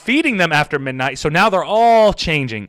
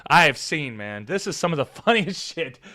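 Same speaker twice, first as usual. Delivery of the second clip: softer, chuckling quoted reaction; amused disbelief; 3 semitones lower, 7 dB quieter than usual. Retold or imitated. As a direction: quietly laugh through the words. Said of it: "I was like".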